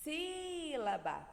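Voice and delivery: SÍlaba with SÍ held long and high; strongest syllable stretched. Shown as a demonstration. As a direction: call out Sílaba, leaning on the first syllable. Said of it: "Qual é o som mais forte?".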